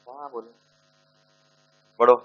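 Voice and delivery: ordinary talk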